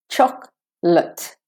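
"Chocolate" slowly as CHOK-lit; two-syllable chocolate. Shown as a demonstration. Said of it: "from a K".

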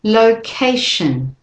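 "Location" slow, stress on ca, weak shun; slow model word. Shown as a demonstration. Teacher reading list words for learners, slow. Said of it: "do it with me".

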